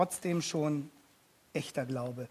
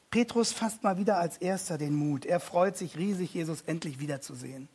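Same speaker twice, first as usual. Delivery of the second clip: ordinary delivery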